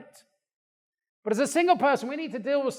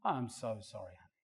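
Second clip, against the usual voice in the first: contrite apology; 11 semitones lower, 17 dB quieter than usual. Retold or imitated. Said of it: "I'm like".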